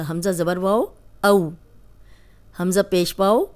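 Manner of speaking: ordinary talk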